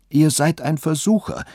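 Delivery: a lightly voiced old woman crying out. A narrator's reading. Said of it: "rief sie aus".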